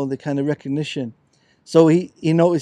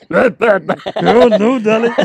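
louder, higher put-on luvvie voice; mock diva; 6 semitones higher, 10 dB louder, much slower than usual. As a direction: ham up a theatrical darling. Mock camp jazz-singer voice.

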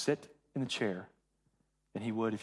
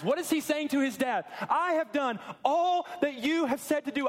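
high, excited preaching voice; preaching at full force; 11 semitones higher, 3 dB louder than usual. Acted.

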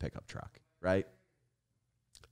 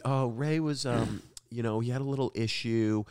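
lightly voiced girlfriend making excuses, a bit higher; hint of awkward covering-up tone; imitating girlfriend; 5 semitones higher than usual. Mocking the girlfriend explaining to the mom, awkward. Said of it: "Just, just like".

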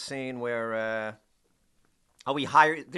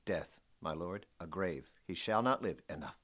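quiet, measured exchange; 6 semitones lower, 7 dB quieter than usual. Retold or imitated. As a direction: weigh each word like a threat. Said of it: "the rhythm of that is so awesome".

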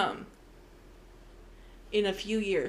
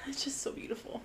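breathless whisper, higher; whispered awe; 4 semitones higher, 12 dB quieter, faster than usual. Real, not acted. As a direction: whisper it, moved by the book. Overwhelmed by the book.

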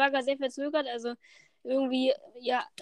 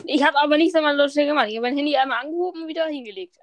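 louder, fast defensive protest; 8 dB louder, faster than usual. Real, not acted. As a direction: push back loudly and quickly. Protesting the accusation.

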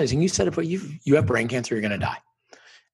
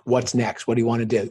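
normal talking voice

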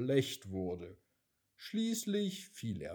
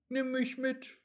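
much higher, small pleading voice; eager raindrop; 13 semitones higher than usual. A narrator's reading. Raindrop asks the wind to take it along, eager and high. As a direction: ask brightly like an eager child.

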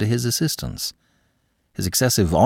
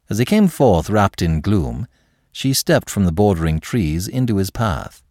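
ordinary delivery, nothing marked

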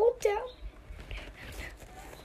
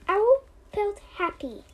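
usual speaking voice

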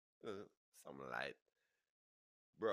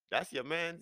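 high, loud disbelief; indignant, pitched-up questioning; incredulous question; 12 semitones higher, 8 dB louder than usual. Retold or imitated. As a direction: challenge him in raised pitch.

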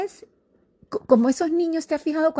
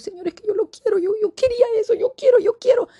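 put-on childish pleading; 13 semitones higher, much faster than usual. Believer whining to God like a child, rising plea. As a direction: beg like a spoiled kid wanting it.

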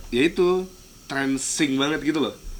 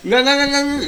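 high, loud, rapid no no no; loud refusal; 12 semitones higher, 5 dB louder than usual. Retold or imitated. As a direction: shut it down loudly. Repeats the refusal.

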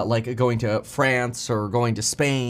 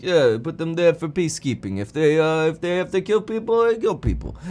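Putin; 3 semitones higher than usual. Acted. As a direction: shrug off all blame, climbing in pitch. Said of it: "washing his hands of".

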